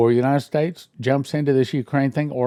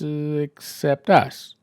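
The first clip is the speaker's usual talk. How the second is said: sly aside; much slower than usual. Pretend. Sarcastic punchline aside.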